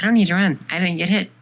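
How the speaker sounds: indignant protest. Acted. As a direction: protest loudly that you're in the clear. Acted protest to the warning.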